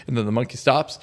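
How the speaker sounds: ordinary talk, nothing marked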